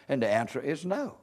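hushed answer. Real, not acted. Preacher answering his own question, low and quiet. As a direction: drop right down for the answer.

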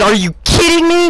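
high-pitched yell of disbelief; retold yell. Fan correcting his retold reaction, high yell. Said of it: "I, I yelled that".

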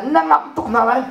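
usual speaking voice

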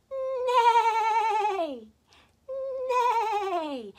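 loud, high neighing horse; whinny it out like a horse for the kids. Pretend. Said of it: "The horse says".

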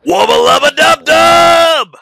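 Rick Sanchez impression. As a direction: bellow it like a gravel-voiced mad scientist. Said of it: "I can actually do a pretty good Rick Sanchez".

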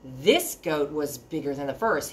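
usual speaking voice

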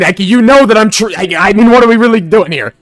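high, loud mock outburst; exasperated retort. Streamer answering viewers who correct his pronunciation, exasperated. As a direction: protest loudly at the nitpicking, pitch pushed up. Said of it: "I'm like".

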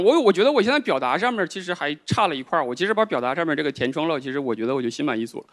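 ordinary delivery

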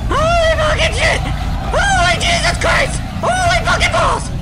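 high-pitched frantic shouting; freaked out. Real, not acted. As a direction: shout in rising panic at the game. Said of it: "Sorry for my voice being so fucking freak showish".

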